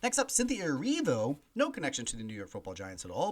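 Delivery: usual speaking voice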